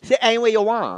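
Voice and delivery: high, put-on host voice; hammed-up high restaurant host; restaurant host character. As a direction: wave guests to a table in character. Restaurant host character seating guests.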